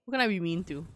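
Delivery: normal talking voice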